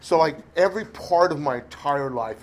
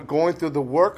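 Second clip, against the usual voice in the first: ordinary talk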